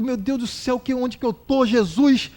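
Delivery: ordinary delivery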